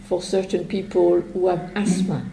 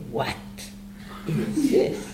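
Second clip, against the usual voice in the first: What set apart, then a long pause; stress on What; 3 semitones lower, 6 dB quieter, slower than usual. Shown as a demonstration. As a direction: hit "What", pause, then finish softly. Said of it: "Where should I put the emphasis?".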